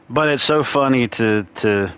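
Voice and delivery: ordinary delivery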